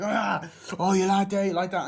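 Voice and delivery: high, loud jeering cry; mock taunting shout. Attackers jeer at their victim, loud mock shout. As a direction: taunt the victim at full volume.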